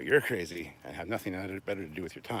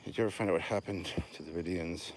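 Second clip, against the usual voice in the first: ordinary delivery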